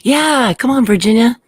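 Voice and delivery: high loud call to the dog. Real, not acted. Calling the dog into room.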